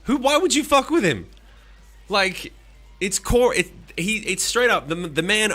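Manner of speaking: loud, high, worked-up ranting; indignant rant; heated rant. Real, not acted. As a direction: fire up and shout the point. Heated rant, much louder and higher.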